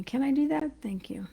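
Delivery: whispered aside. Real, not acted. Asks viewers aside, whispering.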